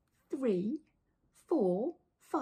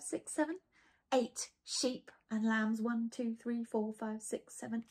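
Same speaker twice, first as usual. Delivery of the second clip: ordinary delivery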